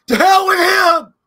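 sobbing, shouted high and slow; crying shout. Retold or imitated. Friend reenacting Will's sitcom breakdown, a crying shout. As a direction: wail it like the famous breakdown scene. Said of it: "he was like".

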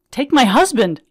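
wife yelling at the paramedics for her husband. Retold or imitated. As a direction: shout across the crowd at them. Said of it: "I'm yelling at them".

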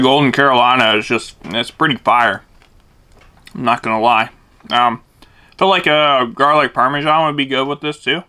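talking between bites; chewing while talking. Real, not acted. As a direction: mouth partly full. Seen 1: Holds sandwich at mouth after a bite; lips and jaw move between words.

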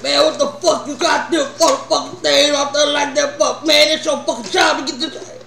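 retold rant at the store worker; mock angry Ray.

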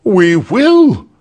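loud, high-pitched eager shout; crowd cry. A narrator's reading. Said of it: "cried everybody".

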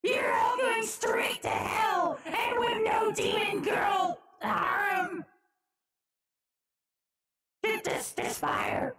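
high, slow screech through a voice filter; distorted high-pitched doomsaying; Toad as a god. Pretend. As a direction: proclaim it as a shrieking filtered deity. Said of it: "what if Toad was God?".

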